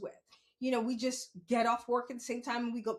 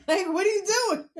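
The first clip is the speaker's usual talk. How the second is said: high, loud, incredulous challenge; indignant protest; 7 semitones higher, 8 dB louder than usual. Retold or imitated. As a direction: call him out, loud and high. Acting her reaction to the rider.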